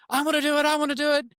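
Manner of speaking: put-on high, loud kid demanding a turn; insistent little-boy voice; eager child. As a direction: imitate a child begging, pitch way up. Older son begs for a turn.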